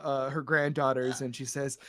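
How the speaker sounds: usual speaking voice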